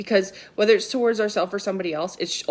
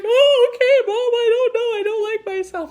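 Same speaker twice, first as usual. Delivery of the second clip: put-on whiny kid voice; 7 dB louder than usual. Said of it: "And he's like".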